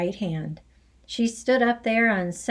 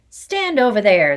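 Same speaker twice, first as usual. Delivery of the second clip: loud curt command; ordered; 2 semitones higher, 8 dB louder than usual. Acted. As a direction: order him aside sharply. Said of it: "the Trunchbull ordered".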